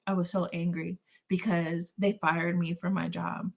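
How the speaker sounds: ordinary talk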